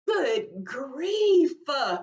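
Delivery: high-pitched exclamation of disbelief; exasperated amazement. Retold or imitated. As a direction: exclaim as if stunned by the comparison. Reacting aloud to daughter's size.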